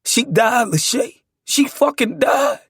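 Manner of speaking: high, loud grieving cry; breaking down. A narrator's reading. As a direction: cry it out in anguish. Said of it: "I continued to break down".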